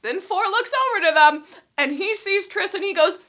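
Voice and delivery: usual speaking voice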